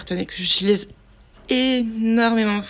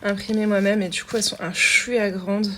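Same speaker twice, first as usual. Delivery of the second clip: ordinary talk, nothing marked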